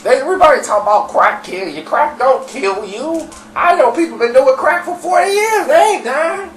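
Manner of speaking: high-pitched, agitated rant; freaking out. Retold or imitated. Dirty defending crack to the room, freaking out. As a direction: argue wildly, voice climbing very high. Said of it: "he freaking out".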